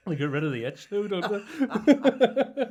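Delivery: can barely get it out for laughing. Real, not acted. Teasing his co-host about talc, laughing.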